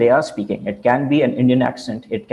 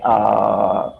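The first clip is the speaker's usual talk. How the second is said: drawn-out uhs between stray words; stalling, searching-for-words filler demo; demonstrating fillers; much slower than usual. Shown as a demonstration. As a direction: hesitate with long, stalling filler sounds. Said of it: "Fillers are those words like".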